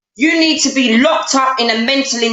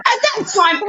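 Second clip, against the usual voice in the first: ordinary talk, nothing marked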